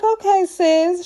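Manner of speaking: unconvinced side-eye reply to a big claim; sceptical okay sis. Retold or imitated. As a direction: say it like you don't buy it one bit.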